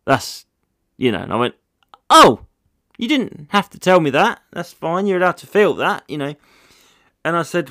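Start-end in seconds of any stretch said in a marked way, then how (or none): 2.1-6.4 mock reassuring. His comeback to bored heckler.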